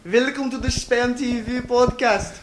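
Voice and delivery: playful fake Spanish accent, drawn out. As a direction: announce it in a hammy Spanish accent. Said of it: "that was my Spanish accent".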